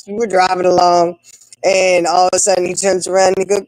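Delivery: ordinary delivery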